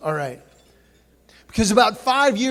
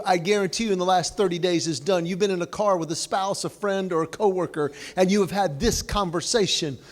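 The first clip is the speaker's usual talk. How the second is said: usual speaking voice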